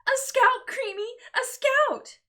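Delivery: very high, loud thrilled shriek. Acted. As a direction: shriek it with pure joy. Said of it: "Miracle shrieked".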